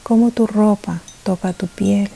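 ordinary talk

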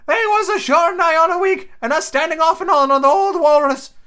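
very high, loud hermit voice; shrill old islander, near shouting; Ben Gunn voice. A narrator's reading. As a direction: tell the secret like an excited castaway. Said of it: "back on this fucking voice train".

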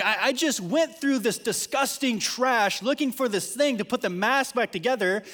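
high, loud retold outburst; exasperated dad. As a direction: replay a dad's worked-up protest. Dad retells his exasperated reaction.

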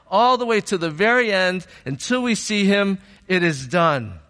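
high-pitched, driving preacher delivery; forceful preaching. Real, not acted. Preacher on knowing Christ until we see him, forcefully. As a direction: build it phrase on phrase, then land firmly.

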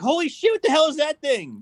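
high-pitched mock alarm; imitated startled disbelief; mock shocked reaction. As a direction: play at being freaked out. Acts out own shocked reaction.

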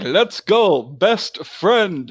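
hyped friend voice. Retold or imitated. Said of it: "he'll be like".

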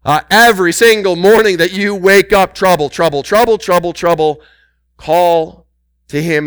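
fervent preaching climax; building shout. Real, not acted. Preacher escalates on trouble.

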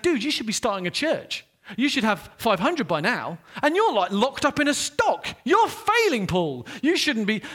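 loud, high scolding mentor voice. Retold or imitated. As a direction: tell Paul off like a disappointed mentor.

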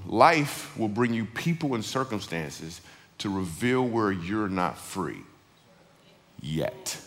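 slow, measured delivery; deliberate saying. Real, not acted. Preacher teaching his favourite saying, slow and deliberate. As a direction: let each phrase land slowly, dropping low and soft on the last word.

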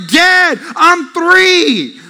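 loud, high mock protest to dad; lightly acted overwhelmed kid; protesting child. Overwhelmed kid to dad at the leaf pile, mock protest.

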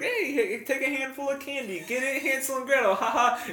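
high, cheery hawker voice; sing-song vendor voice. Retold or imitated. Mock candy vendor at movies.

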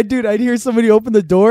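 usual speaking voice

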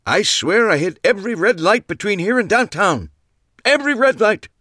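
put-on high, loud grumbling old man; fed-up old card man griping loudly; old man complaining. A narrator's reading. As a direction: kvetch about the red lights like a cranky old-timer. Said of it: "listened to Max complain".

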